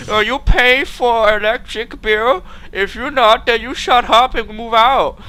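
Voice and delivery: high, loud, slow scolding mom voice; mom's accented voice. Retold or imitated. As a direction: scold like a strict immigrant mother. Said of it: "They're like".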